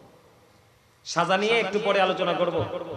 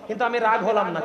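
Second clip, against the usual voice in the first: usual speaking voice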